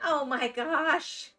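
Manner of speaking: ordinary delivery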